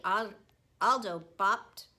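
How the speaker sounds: plain reading voice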